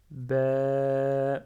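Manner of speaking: BA held very long and loud, "bèèè"; prolonged vowel. Shown as a demonstration. Teacher writes the alif, BA held long. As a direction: stretch BA out on one level note. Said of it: "Et je prolonge".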